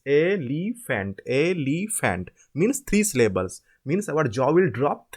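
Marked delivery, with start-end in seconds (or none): 0.1-2.3 elephant drawn out as e-li-fant, slowly; syllable by syllable. Shown as a demonstration. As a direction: break elephant into three slow separate beats. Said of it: "it will be like this".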